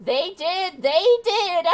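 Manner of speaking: high, knowing granny voice; old lady voice. Acted. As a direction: insist like an old woman. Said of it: "my old lady voice".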